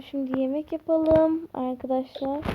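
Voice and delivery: ordinary talk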